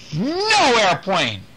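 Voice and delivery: put-on slow, high wailing toddler cry; toddler scream. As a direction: wail like a baby losing his toy. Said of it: "Adam screamed".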